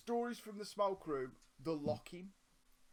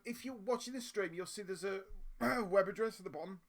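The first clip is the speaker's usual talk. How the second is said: ordinary delivery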